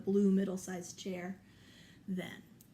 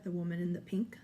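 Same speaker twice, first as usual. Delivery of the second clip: usual speaking voice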